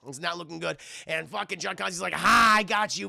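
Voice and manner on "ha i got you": loud triumphant "ha"; mock gloating. John catching the demon in the seal, mock gloating. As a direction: crow over your catch.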